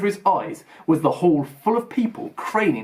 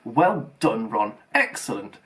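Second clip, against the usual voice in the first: pompous; 4 semitones lower than usual. A narrator's reading. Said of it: "said Percy Weasley pompously".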